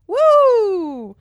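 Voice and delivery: loud high woo; woo howl. Retold or imitated. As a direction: whoop like a crowd. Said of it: "everyone was going".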